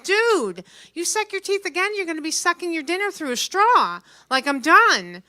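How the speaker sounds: telling off the teeth-sucker; mock threat. Retold threat to rude woman.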